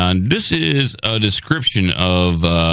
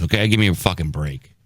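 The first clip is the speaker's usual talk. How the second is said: usual speaking voice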